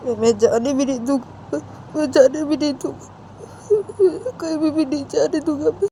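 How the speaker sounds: high, crying protest; cling and refuse through tears, stammering repeats; tearful insistence. Acted. Crying Sanju telling Uma she cannot leave, tearful insistence.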